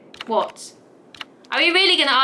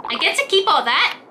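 eager wombat; 5 semitones higher than usual. Acted. Wombat character delighted.

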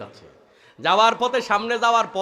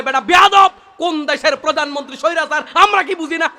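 mock police scolding; 5 semitones higher, 7 dB louder than usual. Police scolding detainee in story.

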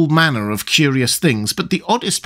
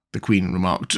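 ordinary delivery, nothing marked

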